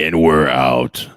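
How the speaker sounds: low, slow, loud sign-off; deep firm voice. Acted. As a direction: drop the voice low and land it slowly. Sign-off at end of stream.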